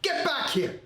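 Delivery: very high-pitched frantic scream; mother's scream. Retold or imitated. Mother at the grocery store to her runaway son, screaming. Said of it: "my mother just screams".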